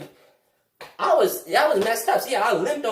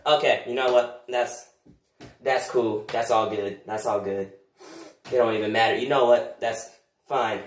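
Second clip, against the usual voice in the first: much lower, dropping to a sulky mumble; muttered bitter resignation; resigned; 10 semitones lower than usual. Acted. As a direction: swallow the anger and give up. Bitter backing down.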